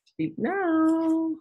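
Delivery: high sing-song call. Acted. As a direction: lilt it up high and playful. Inviting others to talk.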